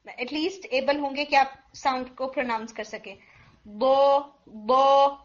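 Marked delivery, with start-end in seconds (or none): 3.6-5.1 بو said loud from the back sides of the tongue; correct bo'at sound. Shown as a demonstration. Teacher to students, modelling the correct back-tongue بو. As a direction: model the right بو from the back molars. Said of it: "یہ sound آنی چاہیے".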